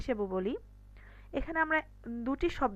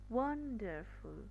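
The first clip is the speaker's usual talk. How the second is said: Wonderful softly, with WAN held long; lengthened first syllable; 3 semitones lower, 8 dB quieter, slower than usual. Shown as a demonstration. Teacher showing longer duration, first syllable lengthened. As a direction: stretch the WAN of wonderful, then finish. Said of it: "ওয়ানটা আমি একটু লম্বা করে বলেছি".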